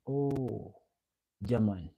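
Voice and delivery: usual speaking voice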